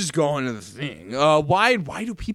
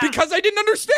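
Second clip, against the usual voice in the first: high defensive protest; faster than usual. Real, not acted. Defending in mock quarrel.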